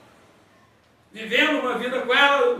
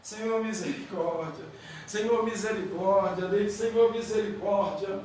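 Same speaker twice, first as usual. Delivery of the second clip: hushed, much lower prayer in pain; quiet pained plea; 7 semitones lower, 9 dB quieter than usual. Retold or imitated. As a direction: murmur for mercy through the pain. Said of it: "Aí eu fico assim".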